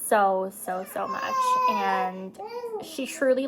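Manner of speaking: sobbing break, pitch jumping up; crying. Real, not acted. Mom crying about her daughter changing her world, voice cracking. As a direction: voice cracks up high on the words. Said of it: "I'm getting really sad".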